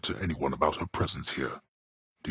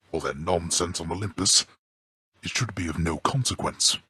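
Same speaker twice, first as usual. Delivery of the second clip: normal talking voice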